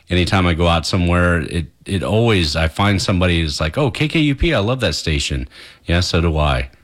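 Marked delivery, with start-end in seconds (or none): 3.8-5.5 higher-pitched mock fan recognition; imitated delighted fan. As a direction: pitch up like a listener spotting the station shirt. Imitates delighted fan spotting shirt.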